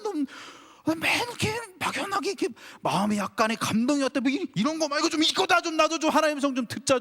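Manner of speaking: 높은 목소리로 답답해 투덜대는 흉내; 속이 터진 듯 하나님께 따지듯; frustrated complaint. Retold or imitated. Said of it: "너무 열이 받아서". (답답하고 억울한 듯 높게 투덜거리기)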